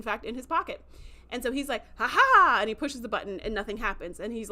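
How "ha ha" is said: loud gloating ha ha; triumphant ha ha. Retold or imitated. Braca crows as he pushes the detonator, loud. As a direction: crow in triumph.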